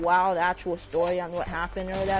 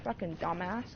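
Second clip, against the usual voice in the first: much softer, under the breath; muttered insult; 2 semitones lower, 10 dB quieter than usual. Real, not acted. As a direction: say it to yourself, disgusted. Muttered aside at friend.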